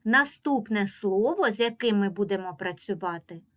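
usual speaking voice

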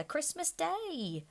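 high, slow gentle reminder; mild answer. A narrator's reading. Bob calming his wife for the children, mild and slow. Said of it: "was Bob's mild answer".

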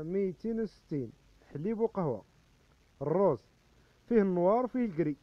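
loud sales cry of price and wares; loud hawking. Acted. Vendor announcing price and colours to the crowd, loudly. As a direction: call it out so the whole street hears.

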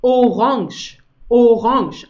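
orange as "o-ronsch", no final e; colour orange. Shown as a demonstration. Teacher modelling the colour word orange, clipped "o-ronsch". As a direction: clip the end: "o-ronsch". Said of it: "die Farbe sprechen wir so aus".

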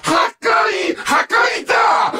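high, drawn-out villain shout; maniacal battle cry. Acted. Villain born for destruction proclaiming it, maniacal shout. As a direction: proclaim destruction like a crazed conqueror.

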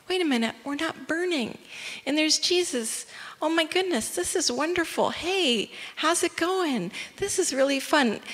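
high, light, chatty amazement; delighted wonder. Acted. Friends in the furnace finding they are not burning, delighted wonder.

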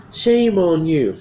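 loud, slow, high reprimand; acted angry telling-off; mad scolding. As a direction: get mad, stretching each word. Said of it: "to get mad at him".

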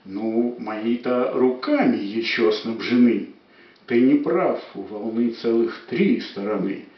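lively, rhythmic verse reading; poetry declamation. Acted. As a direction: read the verse with playful rhythm. Poem declaimed.

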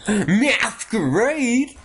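high, drawn-out mock-gleeful cheer; excited cheer. Gamer cheers the game's start, excited. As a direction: stretch it out high, celebrating.

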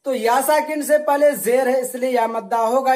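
usual speaking voice

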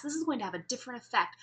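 quick, rushed delivery; speaking fast. Shown as a demonstration. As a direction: rattle it off fast to show speed. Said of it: "if I'm speaking really fast".

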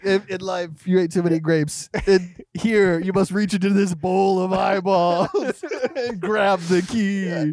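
mock menacing game host; hammed-up killer voice. Playing the trap killer to the victim, hammed-up menace. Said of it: "(after laughs, continues Jenga)".

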